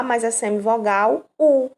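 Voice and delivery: ordinary talk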